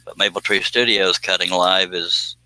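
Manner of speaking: ordinary talk, nothing marked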